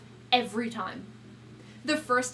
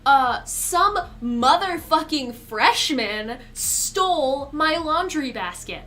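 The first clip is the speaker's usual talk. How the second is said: ordinary delivery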